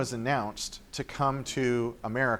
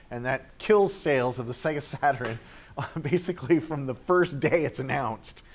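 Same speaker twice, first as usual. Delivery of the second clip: ordinary talk, nothing marked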